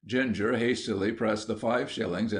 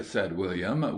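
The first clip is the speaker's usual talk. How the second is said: plain reading voice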